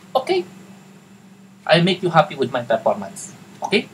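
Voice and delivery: comic Tagalog-accented English with a high-pitched "Okay"; botched Tagalog accent. Shown as a demonstration. Virtual assistant agreeing with the client, comic Tagalog accent. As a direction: exaggerate the Tagalog accent for laughs. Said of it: "ginagawa kong palpak talaga yung Tagalog, yung English accent".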